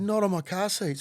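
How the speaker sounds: high, exasperated outcry; hammed-up horror for the precious car seats; indignant protest. Car owner seeing blood, indignant protest.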